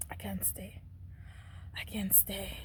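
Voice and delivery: hushed, breath-only whisper; whispered plea. Retold or imitated. Child telling father she cannot stay, whispered plea. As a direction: retell it as a small, whispered plea.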